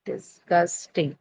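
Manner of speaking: Disgusting drawn out slowly, DIS stressed; teacher slowly sounding out Disgusting; slow stress demo. Shown as a demonstration. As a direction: slowly model Disgusting for the class, leaning on DIS. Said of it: "the stress is on the first syllable".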